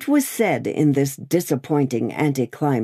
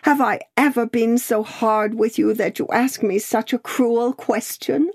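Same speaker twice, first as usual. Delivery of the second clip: higher, hurt reproach; tearful reproach; 8 semitones higher than usual. A narrator's reading. Sister in tears reproaching her cold brother, tearfully. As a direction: plead with him, wounded. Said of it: "bursting into tears".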